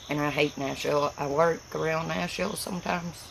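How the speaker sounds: normal talking voice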